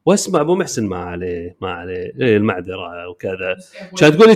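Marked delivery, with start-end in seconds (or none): 0.9-3.6 low, soft and apologetic; meek apology. Retold or imitated. Friend placating the scammer on speaker, low soft apology. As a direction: mumble sorry, sorry, very low and soft.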